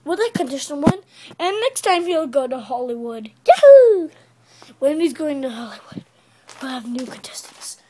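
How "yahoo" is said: loud high yahoo; excited cheer. Pretend. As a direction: whoop with joy. Cheering the winner.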